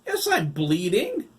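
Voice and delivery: loud, slow, exasperated; exasperated shout. A narrator's reading. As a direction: spell it out, fed up. Todd exasperated answer.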